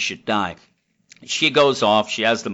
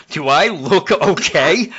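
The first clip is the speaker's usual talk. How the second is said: slow, louder mock snark; sarcastic retort; 5 semitones higher, 5 dB louder, slower than usual. Bloodied Catwoman snapping at her rescuer, slow and louder. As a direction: drawl it back sarcastically. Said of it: "And she's like".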